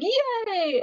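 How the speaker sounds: high, drawn-out happy cheer; mock cheer. Friends hearing Kyo is coming, cheering in delight. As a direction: imitate a delighted group cheer.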